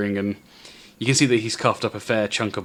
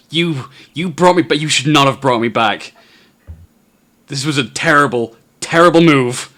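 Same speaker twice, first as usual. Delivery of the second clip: frantic; 4 semitones higher, 8 dB louder than usual. Acted. Frantic NPC after dying.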